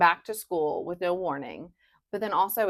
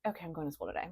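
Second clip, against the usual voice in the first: subdued after pouting; 2 semitones lower, 12 dB quieter, much faster than usual. Retold or imitated. Mom quoting her six-year-old after a pout, subdued. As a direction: imitate a resigned little murmur, much softer. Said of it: "like, pouting".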